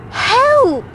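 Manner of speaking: high-pitched cry for help; storybook yelp for help; shouted plea. A narrator's reading. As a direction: call out in sudden alarm. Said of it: "shouted Zog".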